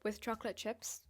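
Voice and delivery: ordinary delivery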